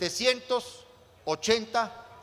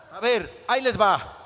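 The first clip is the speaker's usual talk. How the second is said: loud reveal; 7 dB louder than usual. Pretend. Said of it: "Voy a hacer una especie de subasta".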